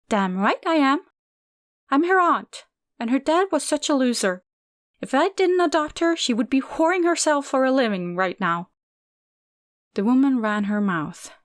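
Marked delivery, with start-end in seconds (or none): 0.1-8.7 raised, higher voice, heated and crude; aunt boasting and sneering; angry rant. A narrator's reading. Aunt rants about her family.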